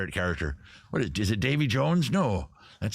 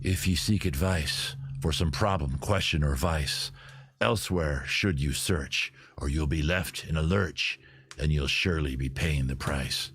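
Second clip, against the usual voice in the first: lower, slow, gravelly pirate purr; gravelly sexy voice; 7 semitones lower than usual. Acted. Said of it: "Sort of the gravelly, sexy voice".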